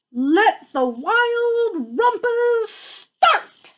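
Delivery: excited cry. Acted. Max crying out to the wild things to start the party. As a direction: sing it out like a thrilled boy king. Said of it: "cried Max".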